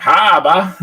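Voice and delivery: mock the Aussie "Harbour" back as a question; hammed-up Australian accent on "Harbour"; exaggerated accent. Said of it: "exaggerate the accent".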